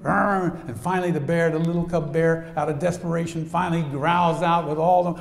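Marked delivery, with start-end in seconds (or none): 0.0-0.6 mock growl "Arr!"; lion's growl. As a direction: growl like a cornering lion. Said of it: "He's going".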